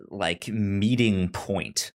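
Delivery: ordinary delivery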